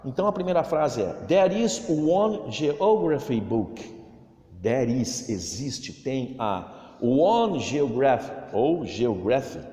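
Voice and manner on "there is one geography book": slow, careful English sentence for repeating; teacher modelling a sentence slowly; slow model reading. Shown as a demonstration. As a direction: read it out slowly so pupils copy. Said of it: "Olha a pronúncia".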